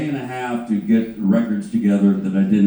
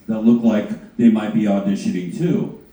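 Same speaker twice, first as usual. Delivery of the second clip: ordinary delivery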